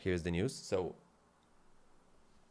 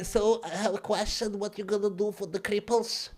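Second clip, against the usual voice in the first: higher, softer put-on voice; 5 semitones higher, 4 dB quieter than usual. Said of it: "The crippled guy".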